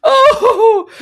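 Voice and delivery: high, loud joyful yelp; ecstatic cry. Real, not acted. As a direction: let out a thrilled cry. Celebrating goal.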